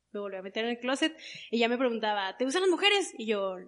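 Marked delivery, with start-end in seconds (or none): 2.4-3.1 mom's question, pitched higher and louder; quoting mom. Retold or imitated. Recalling her mother asking if she likes women, higher pitched.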